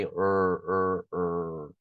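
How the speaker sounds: "er" held with the tongue curled back, no trill; English retroflex R. Shown as a demonstration. Teacher drilling tongue position, er as English R. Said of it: "a língua aí".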